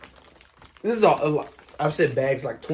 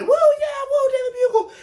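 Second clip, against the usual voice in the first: high, loud hammed-up cheering; mock hype cheer; 6 dB louder than usual. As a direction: cheer it like a hyped fan. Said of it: "be all hype about it".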